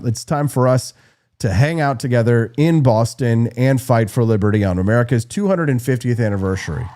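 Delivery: ordinary talk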